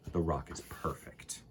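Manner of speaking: hushed storybook whisper. Acted. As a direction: whisper it like a little secret. Secret punchline of the rule.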